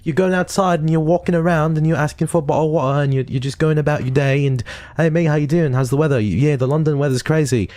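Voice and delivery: high-pitched hammed-up British accent. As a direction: put on a chatty, exaggerated British accent. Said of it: "you drop the British accent".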